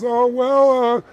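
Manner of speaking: high, loud hesitant offer; hesitant clerk. Retold or imitated. Camera clerk giving in to the wife, high and hesitant. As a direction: cave in reluctantly. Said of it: "he goes".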